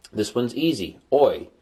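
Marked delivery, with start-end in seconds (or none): 1.1-1.5 "Oi" said like the start of oil. Shown as a demonstration. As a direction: punch out "Oi" as in oil. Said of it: "like oil".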